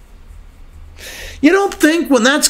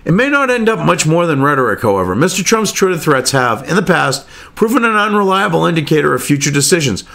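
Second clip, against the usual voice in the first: lower, measured reading-aloud voice; news article read; 7 semitones lower than usual. Acted. Reading the BBC piece aloud to viewers, measured read.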